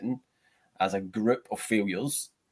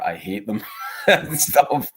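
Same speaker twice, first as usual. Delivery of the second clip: laughing; 8 dB louder, slower than usual. Real, not acted. Laughing while admitting hatred.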